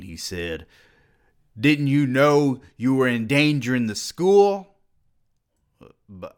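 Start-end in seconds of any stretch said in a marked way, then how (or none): 1.6-4.7 angry scolding. A narrator's reading. Dean scolding.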